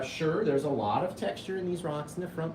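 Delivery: normal talking voice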